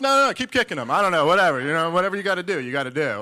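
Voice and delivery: high, loud hammed-up encouragement; mock eager shout. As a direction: egg them on loudly, overplaying it. Mock egging on the attackers.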